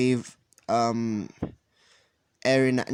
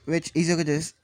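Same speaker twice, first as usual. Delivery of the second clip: ordinary delivery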